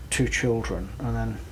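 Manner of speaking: normal talking voice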